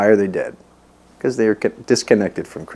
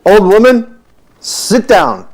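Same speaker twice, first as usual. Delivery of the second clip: higher, much louder stern command; commanding order; 12 semitones higher, 9 dB louder, much slower than usual. Retold or imitated. Prabhupada telling his chattering sister to sit, loud and commanding. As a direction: bark it out like a firm elder brother.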